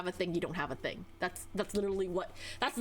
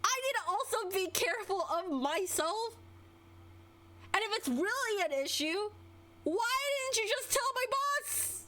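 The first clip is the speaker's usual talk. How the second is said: exasperated rant; 10 semitones higher than usual. Real, not acted. Exasperated rant, high pitch.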